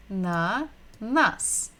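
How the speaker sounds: "ananas" slowly split into a, na, nas; syllable by syllable. Shown as a demonstration. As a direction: sound out "ananas" with a gap after each syllable. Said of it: "listen and repeat".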